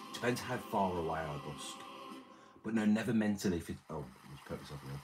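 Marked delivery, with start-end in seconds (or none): none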